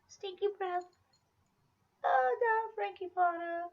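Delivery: high cooing pet voice; high baby talk. Acted. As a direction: coo at the dog. Cooing at the dog.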